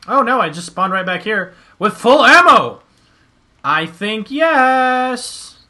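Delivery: loud and thrilled, drawn-out yes; excited. Real, not acted. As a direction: cheer the good luck. Spawns with full ammo.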